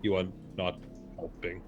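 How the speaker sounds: deadpan. Acted. Gotho quote, deadpan.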